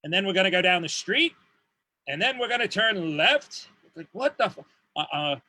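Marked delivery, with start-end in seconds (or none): 0.0-3.7 rising question tune on "street" and "left"; mock uptalk, pitch climbing on "street" and "left"; questioning Australian uptalk. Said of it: "everything in Australian ends with a question".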